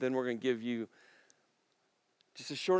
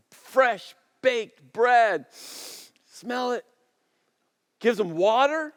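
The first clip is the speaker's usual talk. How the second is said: loud, rising preacher's drive; fervent pulpit preaching, louder as it goes; 7 semitones higher, 9 dB louder than usual. Acted. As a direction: build the sermon to full force. Preaching building in force.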